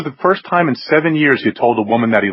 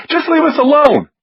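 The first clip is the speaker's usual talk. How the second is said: put-on high, frantic accusing girl; near-shrieking panic; hysterical; 4 dB louder than usual. A narrator's reading. Katie screams then hangs up.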